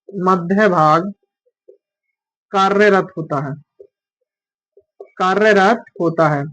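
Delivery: slow, spaced-out dictation with long pauses; teacher dictating notes slowly. Acted. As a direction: read each phrase for students to copy down. Dictating a definition.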